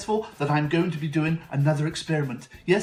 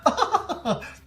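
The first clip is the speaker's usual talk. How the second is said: zany giggle; 4 dB louder than usual. Acted. Host's character laugh.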